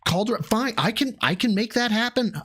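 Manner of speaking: high sneering put-on voice; high sarcastic mimicry; mocking voice. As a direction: mimic him high and snide to ridicule. Mocking Phil's side of story.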